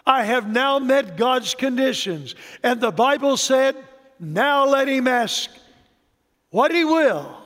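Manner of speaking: rising preaching. Acted. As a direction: climb to a preaching crescendo on the promise.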